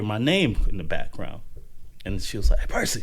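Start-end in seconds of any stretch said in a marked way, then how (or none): 2.6-3.0 higher, sing-song call of a mother spotting her son; mom calling out. Retold or imitated. Mom calling her son's name across campus, high sing-song.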